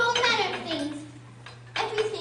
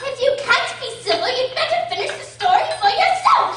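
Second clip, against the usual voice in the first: much louder, indignant outburst; angry; 4 semitones higher, 10 dB louder than usual. Acted. Dormouse scolds Alice for rudeness, loud indignant outburst. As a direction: put her firmly in her place.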